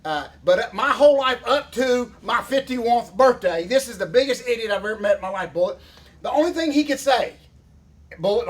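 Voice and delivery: ordinary delivery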